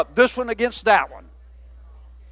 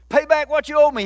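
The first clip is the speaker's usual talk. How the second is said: firm demand, raised pitch; 7 semitones higher than usual. A narrator's reading. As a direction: read it as a higher-pitched, insistent demand. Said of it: "he demanded".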